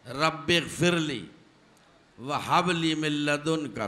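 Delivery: slow, drawn-out Arabic recitation; Quranic recitation. Acted. Preacher reciting Solomon's prayer, slow and chanted. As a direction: recite the verse slowly, reverently.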